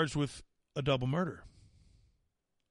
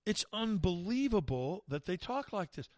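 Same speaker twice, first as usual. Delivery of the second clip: usual speaking voice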